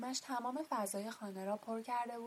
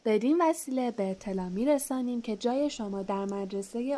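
formal letter reading; 9 dB louder than usual. A narrator's reading.

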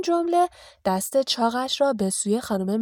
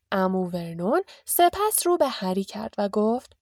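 ordinary delivery

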